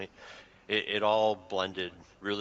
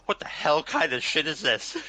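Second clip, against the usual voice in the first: loud, higher-pitched incredulous rant; amused outrage; 6 semitones higher, 7 dB louder than usual. Real, not acted. Reviewer ranting about a silly plot twist, amused outrage. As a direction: burst out in laughing disbelief at the absurdity.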